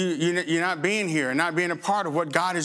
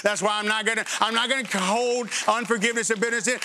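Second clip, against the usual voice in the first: ordinary talk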